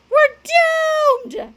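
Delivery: high, loud wail of doom; panicked shout. A narrator's reading. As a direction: wail it in cartoon despair. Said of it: "shouted Cheeseball".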